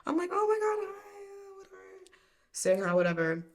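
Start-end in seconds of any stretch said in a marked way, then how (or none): 0.3-1.8 very high, put-on squeal of recognition; fake friendly greeting. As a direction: play along in a sugary high voice. Playing along with greeting.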